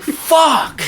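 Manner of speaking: high, drawn-out mock cry of frustration; exasperated outburst. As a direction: curse as the idea slips away. Acts out realizing dream is forgotten.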